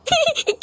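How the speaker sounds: short high laugh. Acted. Laugh alone on line.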